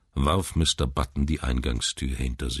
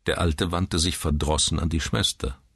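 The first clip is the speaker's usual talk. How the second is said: usual speaking voice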